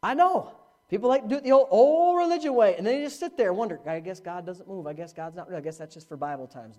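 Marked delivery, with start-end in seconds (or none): 3.8-6.8 soft, low resigned grumble; resigned doubter mumble. Retold or imitated. Preacher voicing doubters who gave up, soft resigned mumble. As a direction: mumble it like you've given up.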